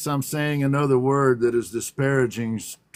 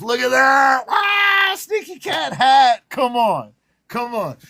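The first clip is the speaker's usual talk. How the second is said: high, loud delighted exclamation; excited showing off; 7 semitones higher, 4 dB louder than usual. Real, not acted. Host showing the Sneaky Cat hat to viewers, thrilled. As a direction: gasp with joy at the hat.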